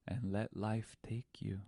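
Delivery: hushed reflective whisper. Real, not acted. Telling listeners the lesson, hushed whisper. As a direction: let the lesson land in a whisper. Soft moral close.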